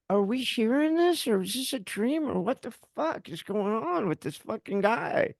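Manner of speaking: high, dazed disbelief; retold shock. Couple looking at each other after the diagnosis, stunned. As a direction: question it as if unreal, pitch held high. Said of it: "we're in shock".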